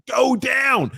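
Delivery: high, loud frantic plea; yelling at the TV. Retold or imitated. Fan yelling at the screen to go down. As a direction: beg him to go down.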